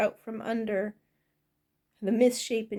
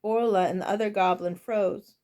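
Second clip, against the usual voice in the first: usual speaking voice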